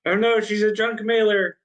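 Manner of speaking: high, loud comic wail; mock dismay. Game master joining the junk mail joke, mock dismay. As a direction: cry it out in playful horror.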